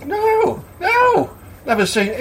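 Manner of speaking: very high, wide-eyed noes; amazed denial. Acted. Customer answers landlord about the dog, amazed. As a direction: squeak the noes high, dropping at the end.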